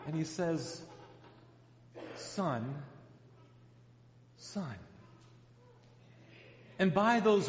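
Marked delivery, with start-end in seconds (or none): 2.2-4.8 soft, gentle calling; a father forgiving his son; tender father. Acted. Father gently answering his resentful son, soft and tender. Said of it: "ignore those insults".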